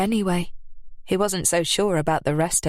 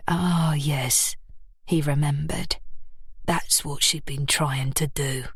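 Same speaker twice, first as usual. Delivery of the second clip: lightly lower man's voice; 4 semitones lower than usual. A narrator's reading. Connor's voice.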